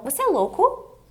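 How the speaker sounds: "louco?" pitched high and rising; question intonation. Shown as a demonstration. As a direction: lift "Você é louco?" into a clear question. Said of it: "Faz entonação de pergunta".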